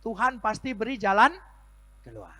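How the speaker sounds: high, loud sermon; full-force preaching. Acted. Pastor exhorting the congregation, high and loud. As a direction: rouse the congregation.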